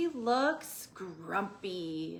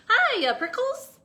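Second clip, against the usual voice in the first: much higher, louder perky greeting; shout; 14 semitones higher, 10 dB louder than usual. Acted. Jackrabbit shouting a cheeky hello. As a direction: call out teasingly. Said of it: "she shouted".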